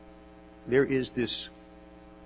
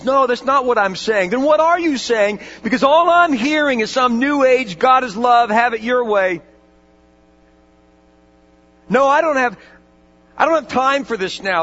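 louder and higher, heated and impatient; angry retort; 9 semitones higher, 10 dB louder than usual. Retold or imitated. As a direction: push back hard at the chaplain. Heated argument with chaplain.

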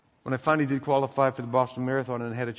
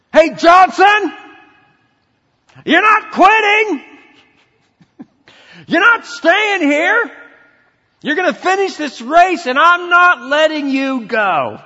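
full-force shout, high and drawn out; yelling; 16 dB louder, slower than usual. Retold or imitated. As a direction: yell it at a struggling runner like a fierce order. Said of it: "he started yelling at me".

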